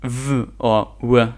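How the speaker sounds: "V" as a buzzed v sound, "W" as a rounded w. Shown as a demonstration. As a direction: say "V or W" as bare sounds, vvuh or wuh. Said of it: "You can still pronounce the V or W as".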